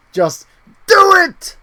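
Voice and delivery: sudden high yelled just do it; Shia LaBeouf shout. Retold or imitated. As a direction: bellow it like a manic motivational speaker. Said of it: "Shia LaBeouf motivate me".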